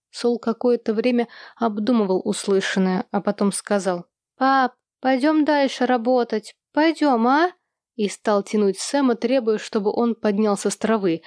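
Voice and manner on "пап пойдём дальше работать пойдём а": put-on higher, coaxing child's voice; pleading child. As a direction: tug at dad, whining to go.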